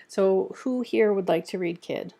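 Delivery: ordinary delivery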